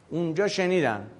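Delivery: ordinary talk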